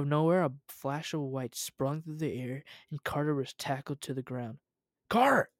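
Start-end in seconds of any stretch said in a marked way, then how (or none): 5.1-5.5 loud, high yell of a name. A narrator's reading. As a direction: yell out to your partner. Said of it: "yelled Jim".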